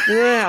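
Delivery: imitated child crying. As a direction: fake a kid's bawling "wah". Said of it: "all you hear while this priest is on".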